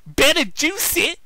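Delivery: high, slow urgent cry; urgent hero line. Acted. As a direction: rev up to bolt away. Sonic fleeing robots.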